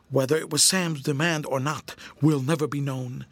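ordinary delivery, nothing marked

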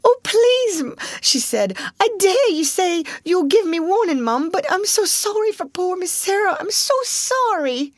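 very high, wailing plea; tearful pleading. A narrator's reading. Maid begging her mistress through tears. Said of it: "She burst into fresh tears".

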